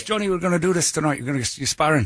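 imitating an Irish boxing trainer's accent; brief them in a mock Irish lilt. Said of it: "I used to have an Irish boxing trainer".

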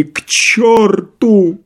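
high, long wail of anger; tearful shout. A narrator's reading. Doctor running after his guests, tearful shout. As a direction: wail it out, stretched. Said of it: "крикнул он плачущим голосом".

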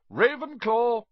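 loud, high triumphant shout; hat shouting. Acted. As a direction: bellow the house across the hall. Hat announces the house.

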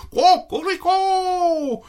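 very high, stretched-out crow; rooster crow. Acted. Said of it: "hurla le coq".

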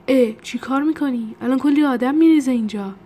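loud, high-pitched alarm; alarmed scolding. Acted. Scolding a friend who hit the alarm button, alarmed shout.

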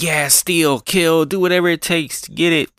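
high-pitched, hammed-up goading; villain egging on. Queen encouraging the free-for-all, gleeful mock goading. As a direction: cheer on the mayhem as a mock villain.

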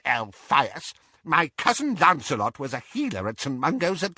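high, emphatic know-all aunt; Auntie Muriel, triumphant. A narrator's reading. Auntie Muriel correcting Elphias, high and triumphant.

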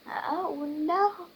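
high, mild wail of dismay; acted high-pitched lament; crying out. A narrator's reading. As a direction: cry out in storybook distress.

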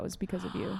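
whispered confession; whispering. Real, not acted. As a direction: whisper it warmly. Confiding, whispered.

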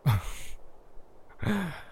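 soft quick chuckle before speaking; a little amused laugh. A narrator's reading. Gandalf amused by his friends, soft laugh. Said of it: "Suddenly Gandalf laughed".

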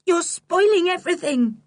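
voice breaking. Acted. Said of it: "with a break in her voice".

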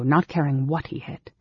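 ordinary delivery, nothing marked

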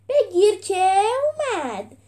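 high, loud, drawn-out yell; slow, gloating shout before the blow; battle cry. A narrator's reading. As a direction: stretch the cry as you strike. Fighter's cry before final blow.

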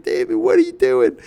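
high, alarmed mom calling out my name; imitating mom. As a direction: shriek it like a startled mother. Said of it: "My mom comes running out. She's like".

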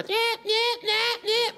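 high, insistent nagging beeps; imitated car seatbelt alarm. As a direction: mimic a car warning chime with "nyeh". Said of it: "it's car".

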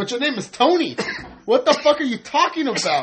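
high, loud mock disbelief at a coworker; hammed-up baffled outburst; mock incredulous fan. Mocking a coworker who calls himself Chris, high and loud.

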